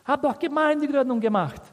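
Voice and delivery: high-pitched frustrated protest. Acted. Paul protesting he was there, acted frustration. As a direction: plead your case, let down and exasperated. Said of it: "Er war frustriert".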